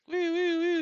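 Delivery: playful high hooting; silly high woo woo; high woo calls. Acted. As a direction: sing out the woos up high. Mimics a sound from the monitor.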